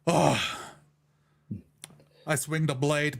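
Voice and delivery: ordinary delivery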